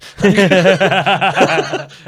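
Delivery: loud, high-pitched burst of laughter; long, helpless cackling laugh; big laugh. Real, not acted. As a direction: crack up loudly at the punchline. Laughs at own champagne punchline.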